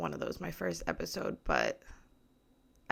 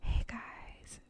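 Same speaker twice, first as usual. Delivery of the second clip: soft, voiceless ASMR hello; whispered greeting; 6 dB quieter than usual. Shown as a demonstration. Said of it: "I need to whisper".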